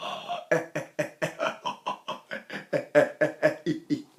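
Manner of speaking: unvoiced inward gasp; silent gasp. Shown as a demonstration. Teacher showing the Mueller maneuver. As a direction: suck air in silently. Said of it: "So I go".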